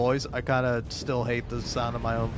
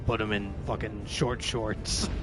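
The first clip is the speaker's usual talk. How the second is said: Batman impression; 4 semitones lower than usual. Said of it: "this is my Batman impression".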